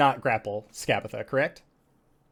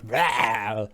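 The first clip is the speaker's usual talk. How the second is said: loud, abrupt "rah" whoosh; poison burst noise; 4 semitones lower, 6 dB louder than usual. Acted. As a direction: imitate a sudden flash of poison. Imitating a flash of poison.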